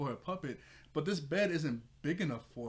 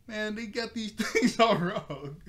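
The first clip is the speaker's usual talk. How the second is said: much higher, louder, slow through laughter; laughing; 8 semitones higher, 9 dB louder, slower than usual. Retold or imitated. The reviewer quoting Beast Boy's puppet complaint while laughing. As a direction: quote it while cracking up.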